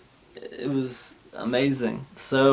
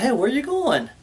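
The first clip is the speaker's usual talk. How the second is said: dentist calling out; 10 semitones higher than usual. Retold or imitated. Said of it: "she's like".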